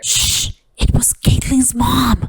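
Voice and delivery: hushed secret whisper. Pretend. Telling viewers a secret in a whisper. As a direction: lean in and whisper the reveal.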